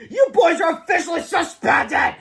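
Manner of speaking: slow, high, loud proclamation; triumphant mean principal; gloating shout. A narrator's reading. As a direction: savour the sentence as you shout. Principal gloats at suspending boys.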